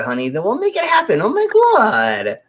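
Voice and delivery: high, loud, sassy drag queen. Acted.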